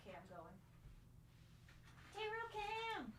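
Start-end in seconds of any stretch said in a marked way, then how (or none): 2.1-3.1 high, stretched call; playful announcement. Real, not acted. Streamer announcing the cat camera, high and playful. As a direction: announce the cat cam like a show.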